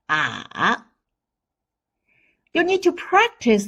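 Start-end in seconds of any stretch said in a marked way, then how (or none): 0.1-0.8 "Ah" dipping low, then swinging up; falling-rising tone. Shown as a demonstration. Teacher demonstrates third tone, low dipping ah. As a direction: sink on "Ah", then lift it. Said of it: "The third tone is the falling rising tone".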